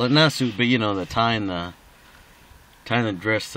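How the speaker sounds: ordinary talk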